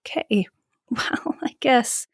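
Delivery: breathy, near-whispered acceptance; retold reluctant, whispery okay; deflated resignation. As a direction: sigh it out under your breath, giving in. Retelling her deflated reaction to pandemic.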